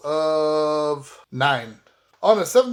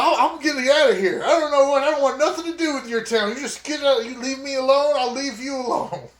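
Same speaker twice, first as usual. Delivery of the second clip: higher, fast and heated; in-character brush-off; 7 semitones higher, faster than usual. Acted. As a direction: push him away, fast and higher. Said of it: "I was like".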